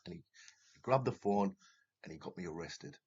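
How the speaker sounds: ordinary delivery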